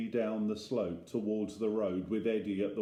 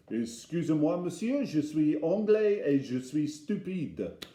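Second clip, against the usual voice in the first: put-on English-accented French; 5 semitones higher than usual. Dad speaking to a French farmer, English-accented French.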